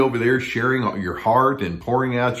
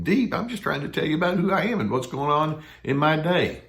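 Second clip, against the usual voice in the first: incredulous; 7 semitones higher than usual. Retold or imitated. Incredulous reply to the avoider.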